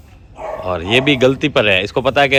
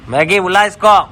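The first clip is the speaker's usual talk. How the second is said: calling out; 7 semitones higher, 6 dB louder than usual. Real, not acted. Calling dog.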